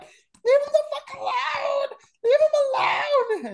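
very high shrieked imitation of a woman; frantic girlfriend. Girlfriend hitting him during the fight, frantic screeching imitation. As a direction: screech it in falsetto, wailing the last word. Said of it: "His girlfriend is sitting here just fucking hitting me like".

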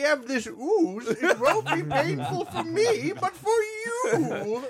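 high, slow bad Japanese accent; hammed-up Japanese accent villain. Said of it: "you're using a bad Japanese accent".

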